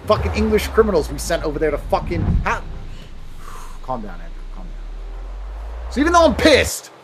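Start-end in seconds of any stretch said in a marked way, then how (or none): 2.7-3.8 slow, soft exhale; long calming sigh. Acted. Calming himself after rant. 3.8-5.9 very low, hushed self-talk; self-soothing whisper. Acted. Talks himself down after rant.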